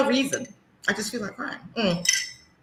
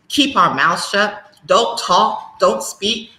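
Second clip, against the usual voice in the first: ordinary talk, nothing marked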